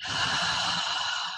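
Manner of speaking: soft, airy exhale; gentle meditative sigh; long relaxing sigh. Real, not acted. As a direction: let out a slow, quiet breath. Breathing out during guided meditation.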